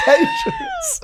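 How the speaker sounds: high squeaky laughing repeat; laughing echo. Acted. Listener echoes in mock voice.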